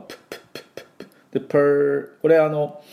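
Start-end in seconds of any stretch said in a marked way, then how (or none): 0.0-1.4 quick voiceless p puffs; fast breathy p sounds; voiceless p pops. Shown as a demonstration. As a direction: puff a row of light p bursts. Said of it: "Pの音".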